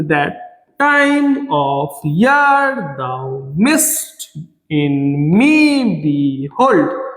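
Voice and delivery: TIME, YEAR, MIST, ME drawn out over low unstressed syllables; very slow scansion chant, swelling on each second syllable; exaggerated iambic stress. Shown as a demonstration. Teacher showing students where the stress falls, exaggerated iambic stress. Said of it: "যেটার মধ্যে আমরা জোর দিচ্ছি".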